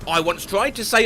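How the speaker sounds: normal talking voice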